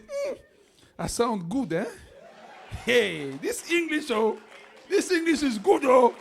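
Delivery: high, loud proud exclamation in English; self-admiring. Retold or imitated. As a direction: marvel at your own English. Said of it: "I'm saying". (s'admirer, voix haute et fière)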